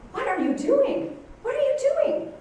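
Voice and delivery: high, stunned, disbelieving question; shocked disbelief. Retold or imitated. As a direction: retell the outrage, pitch jumping up. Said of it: "That was exactly my reaction".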